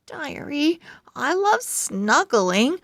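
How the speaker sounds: ordinary delivery, nothing marked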